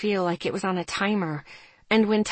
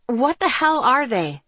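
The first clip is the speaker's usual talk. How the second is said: higher-pitched exasperated question; heated demand; 6 semitones higher, 4 dB louder than usual. A narrator's reading. Narrator pressing Serena for answers, exasperated.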